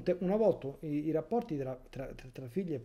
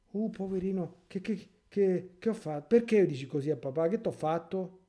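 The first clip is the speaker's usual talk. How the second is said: mocking whiny father; 5 semitones higher, 5 dB louder than usual. Priest mocks a father begging his son, whiny. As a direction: ham up a pleading daddy.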